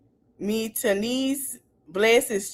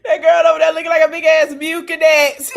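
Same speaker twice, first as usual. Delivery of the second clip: words pushed out through real laughter, louder and fast; can barely get it out for laughing; real giggles spilling through the line; 5 semitones higher, 6 dB louder, faster than usual. Real, not acted. Cracking up at viewer's look.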